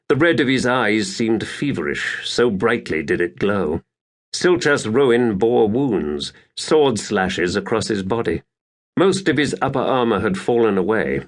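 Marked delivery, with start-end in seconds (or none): none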